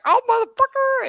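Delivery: hammed-up high wail; mock panicked yell. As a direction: imitate a sprayed man crying out. Imitating sprayed man stumbling into cars.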